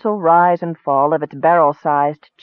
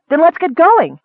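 high, loud, eager call to move; excited shout; 12 semitones higher, 5 dB louder than usual. A narrator's reading. Kender rallying the elf to set off, excited shout. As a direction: cry out, raring to go. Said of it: "shouted the kender".

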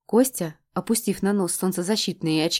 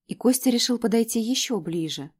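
usual speaking voice

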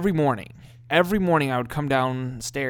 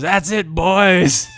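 very slow, louder, drawn-out dad voice; dad's line; 5 semitones higher, 9 dB louder, much slower than usual. Retold or imitated. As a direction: drawl it like a smug dad sipping coffee. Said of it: "turn to us and go".

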